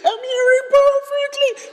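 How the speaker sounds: high, loud mimicked joyful crying; crying woman. Preacher imitating the healed lady's high tearful cry. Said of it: "she started crying".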